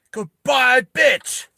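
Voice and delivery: high, shouted parting shot; loud villain farewell. Acted. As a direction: yell a triumphant goodbye. Villain's acted parting shot with grenade.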